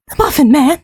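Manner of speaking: squeaky high Muffin Man; high-pitched voice. Shown as a demonstration. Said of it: "it just hits the right area".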